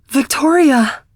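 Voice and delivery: loud, high cry of her name; recognizing her old teacher, crying out with joy; joyful cry. Acted. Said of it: "I cry".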